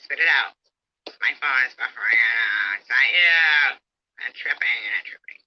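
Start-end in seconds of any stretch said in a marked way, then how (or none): 4.3-5.4 whispered aside. Real, not acted. Muttering aside during argument.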